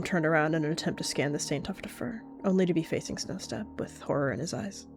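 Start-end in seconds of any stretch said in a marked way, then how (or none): none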